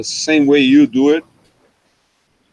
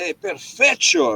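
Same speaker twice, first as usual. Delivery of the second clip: retold perfecto; 10 semitones higher, slower than usual. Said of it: "I said".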